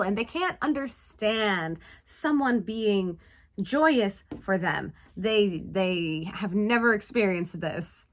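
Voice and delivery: ordinary delivery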